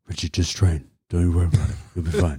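offhand impression of a reassuring coach; George impression. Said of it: "I do a good George impression".

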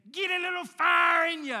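high, loud Southern coach's holler; Southern-accented yell. Retold or imitated. Preacher imitating his childhood football coach, Southern-accented yell. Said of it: "he had this Southern accent".

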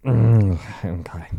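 lightly imitated drunken mumble; grunt, then agree like a drunk; drunk acceptance. Drunk friend takes the table number, dopey mumbled agreement.

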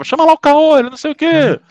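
high-pitched offhand summons; exasperated, playful calling; mock calling out. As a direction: rattle off nicknames as if sending for someone. Acts out calling people by nicknames.

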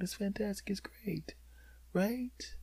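conspiratorial whisper; sneaky hush. Retold or imitated. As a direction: hush it like a secret plan. Sneaky quiet narration.

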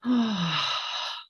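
long slow audible exhale; open mouth sigh. Shown as a demonstration. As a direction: let the breath out through an open mouth. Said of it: "out with an open mouth sigh".

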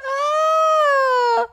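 shrill falsetto yelp; piercing high-pitched yelp; very high startled scream. Retold or imitated. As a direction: scream as you land on bodies. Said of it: "You're like".

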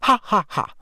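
high, dry fake laugh; sarcastic spelled-out laugh. A narrator's reading. Hermione answering Ron's goblin joke, sarcastic. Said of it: "said Hermione sarcastically".

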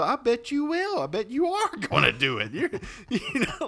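high, loud, giggling agreement; high gushing, laughing; sweet flatterer. Acted. Flatterer answering the threat.

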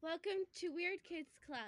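high airy ditzy greeting; ditzy bimbo welcome. Pretend. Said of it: "like, bimbo voice?".

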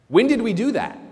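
high, surprised little question; asking in genuine wonder; puzzled sheep. Acted. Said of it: "their responses are actually almost identical".

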